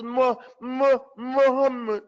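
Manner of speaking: high "Mu-, Muhammad" with swallowed vowels; mispronounced, mouth closed. Shown as a demonstration. Teacher mimics how the unstudied one says it, mispronouncing. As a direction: stutter out "Mu-, Muhammad", lips hardly moving. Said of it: "He doesn't open up his mouth".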